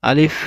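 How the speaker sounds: আলিফ said loud with a cracked start, breath escaping; cracked alif. Shown as a demonstration. Teacher drills the alif, loud and cracked. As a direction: burst the আলিফ out with a puff of air. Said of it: "একটু ফাটা উচ্চারণ করতে হবে".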